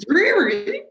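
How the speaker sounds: mock British "Dreary?" rising high; British husband. Husband mocking her word, in a put-on British voice. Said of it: "He goes".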